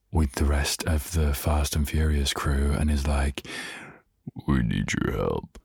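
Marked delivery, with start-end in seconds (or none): 4.5-5.6 Vin Diesel impression. Vlogger imitating Vin Diesel asking for help, low. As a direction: drop low and grave like a movie tough guy. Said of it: "Is that how Vin Diesel talks?".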